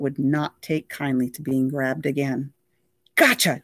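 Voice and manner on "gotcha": triumphant crow, a bit higher and louder. Acted. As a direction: crow it like you just won. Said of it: "Derek crowed".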